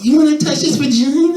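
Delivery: high breathy-free flirt voice. Retold or imitated. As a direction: tease him like a gym flirt, pitched high.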